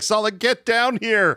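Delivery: high, drawn-out mock call. He calls Sala to get down here, mock calling out. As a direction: call down to a friend, stretching the words. Acted quote, calling someone down.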